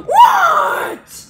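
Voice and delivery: very high gasping shout; gasp, then shriek it; shocked gasp. Acted. [name]'s put-on voice.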